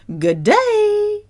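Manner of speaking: very high, held "day"; high-pitched singsong hello; sing-song greeting. Shown as a demonstration. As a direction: chirp it way up high.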